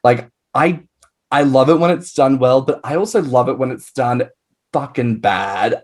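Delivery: normal talking voice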